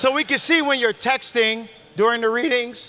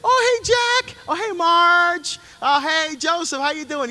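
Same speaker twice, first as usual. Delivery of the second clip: high, bright fake-friendly hellos, stretched; fake cheery greeting; 7 semitones higher than usual. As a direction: greet everyone like a phony saint. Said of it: "put on a good show".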